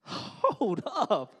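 loud, sharp high warning; dad cutting off his daughter's cussing; stern dad. Retold or imitated. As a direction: stop her cold with a loud dad command. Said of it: "And I said".

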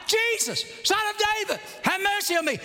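high, short-held cries; acted yelling. Blind man yelling out to Jesus, brisk acted yell. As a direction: yell it high, falling off toward the end. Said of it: "Blind man yelling out".

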